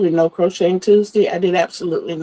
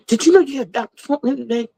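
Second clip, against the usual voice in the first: quick, higher daughter voice; imitating excited daughter; 4 semitones higher, faster than usual. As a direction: mimic her rushed excitement. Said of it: "she's excited".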